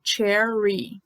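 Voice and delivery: "Cherry" with a drawn-out English r. Shown as a demonstration. Teacher demonstrates the R to learners, English r in "Cherry". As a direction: curl and hold the r in "Cherry". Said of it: "E o som do R".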